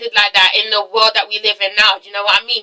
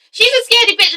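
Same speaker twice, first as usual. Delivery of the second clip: mocking high squeal; 10 semitones higher, faster than usual. Shown as a demonstration.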